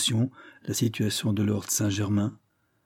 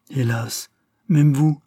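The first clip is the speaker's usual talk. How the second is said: reproachful cry of hurt, louder; ton de reproche; 4 semitones higher, 6 dB louder, slower than usual. Acted. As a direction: reproach him, wounded. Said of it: "me dit-elle d'un ton de reproche". (d'un ton de reproche, plus fort)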